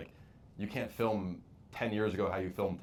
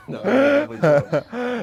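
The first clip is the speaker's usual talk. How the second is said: laughing high; 16 dB louder than usual. Real, not acted. Laughing off the teasing.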